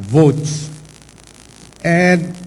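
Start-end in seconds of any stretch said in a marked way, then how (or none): 0.1-0.7 "votes." set apart after a pause and said firmly; "votes." singled out and pressed; stress on votes. Shown as a demonstration. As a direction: give "votes." its own beat. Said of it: "I would like to emphasize the word".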